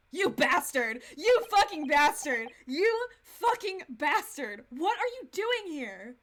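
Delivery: loud, slow, drawn-out cursing; outraged yelling. Real, not acted. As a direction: hammer out each word in loud outrage. Outraged at sick character turning up.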